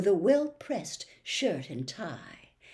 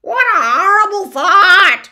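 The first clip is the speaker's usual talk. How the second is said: very high, loud horrified cry; shuddering horror; 13 semitones higher, 18 dB louder than usual. Acted. The bird recoils at going home, high and loud. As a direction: recoil from the very idea. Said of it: "the bird shuddered".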